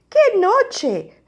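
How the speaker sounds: high, loud mole's complaint; grumbling. A narrator's reading. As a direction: grumble it as an exclamation, pitched well up. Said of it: "rezonga Topo".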